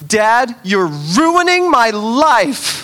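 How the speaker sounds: loud, slow whiny shout; upset child. Retold or imitated. Daughter Penny shouting at dad, slow whiny wail. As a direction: wail it like a six-year-old. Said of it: "she goes".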